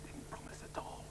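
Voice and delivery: soft unvoiced whisper under the breath; whispered aside. Whispering aside to the puppets after a fake promise. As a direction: breathe it so Sue can't hear.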